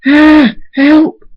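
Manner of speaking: very high, loud shriek; screamed cry for help. A narrator's reading. Twilight attacked by wolves, screaming for help. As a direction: scream desperately for rescue. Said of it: "Twilight screams".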